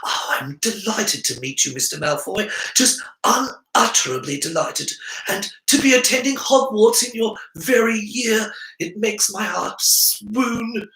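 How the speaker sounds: high, eager fawning voice; lightly hammed-up gushing boy; gushing Harry. A narrator's reading. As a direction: gush like an overawed fan. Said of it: "Harry burbled on".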